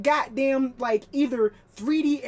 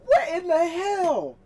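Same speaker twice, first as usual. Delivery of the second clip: amused disbelief; 7 semitones higher, 4 dB louder, slower than usual. Real, not acted. Disbelief at the old design.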